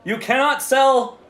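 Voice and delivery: loud, high "whoa" commands; police halt. Acted. Police officers halting the demon vendor, loud and high. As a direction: stop her in her tracks.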